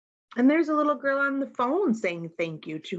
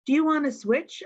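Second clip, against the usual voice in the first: put-on friendly kid's offer; 7 semitones higher, faster than usual. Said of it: "Ahmad asks".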